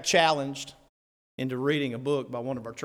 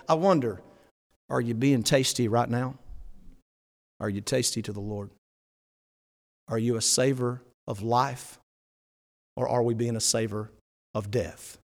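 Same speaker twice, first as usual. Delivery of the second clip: ordinary delivery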